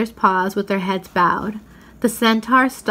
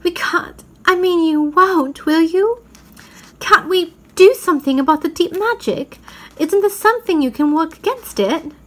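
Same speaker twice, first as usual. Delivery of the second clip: much higher, anxious pleading; pleading girl; 9 semitones higher than usual. Acted. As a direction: beg the lion to find a way. Susan pleading with Aslan.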